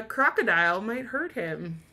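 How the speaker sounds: usual speaking voice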